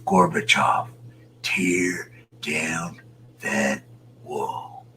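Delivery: slow, drawn-out whispered Reagan quote; a hushed, stretched-out mock Reagan; imitating Reagan. To a viewer, retelling Reagan's Berlin speech, whispered slowly. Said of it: "That's what Ronald Reagan said".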